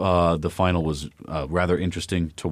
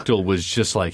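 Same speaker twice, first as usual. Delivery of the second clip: ordinary delivery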